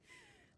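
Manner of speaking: quiet pleased chuckle; soft amused laugh. Acted. Charmed by the compliment.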